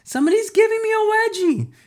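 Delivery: very high, strained whine of a reporter; high-pitched squeaky reporter voice; squeaky complaint. A narrator's reading. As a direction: squeal it like someone caught in a wedgie. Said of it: "another reporter complained".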